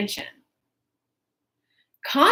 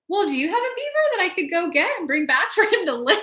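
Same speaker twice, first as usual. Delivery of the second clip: naive question; 8 semitones higher, faster than usual. Retold or imitated. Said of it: "I was like".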